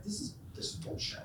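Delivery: half-whispered scoff; grumbling relatives. Retold or imitated. Relatives scoffing at Cincinnati chili, whispered. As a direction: mutter it under your breath in disgust. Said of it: "They're like".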